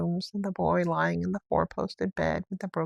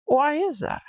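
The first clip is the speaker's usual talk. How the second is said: anxious master; 8 semitones higher, 4 dB louder than usual. A narrator's reading. Craven asks.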